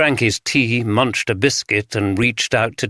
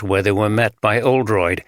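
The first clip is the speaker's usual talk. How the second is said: ordinary delivery, nothing marked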